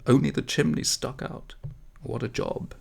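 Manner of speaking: ordinary delivery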